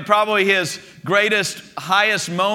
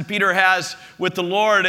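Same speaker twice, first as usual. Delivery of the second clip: normal talking voice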